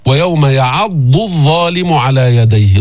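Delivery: slow, with يعض on dad and الظالم on dha; correct recitation. Shown as a demonstration. Teacher giving students the correct reading, slow. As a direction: recite it the right way, slowly. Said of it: "ما الصواب؟".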